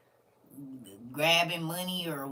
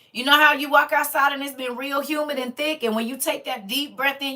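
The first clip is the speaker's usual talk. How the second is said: higher, louder, passionate urging; fervent and loud; 8 semitones higher, 11 dB louder than usual. Real, not acted. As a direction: pour intensity into it like you feel it now. Intense spiritual warning to listeners.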